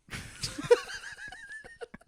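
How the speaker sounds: high-pitched burst of giggling; high giggle. Real, not acted. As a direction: giggle at your own joke. Laughs at his own suggestion.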